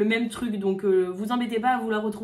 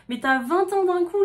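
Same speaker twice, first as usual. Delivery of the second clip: mock alarm; 7 semitones higher, 7 dB louder than usual. Panique jouée à ses vingt ans.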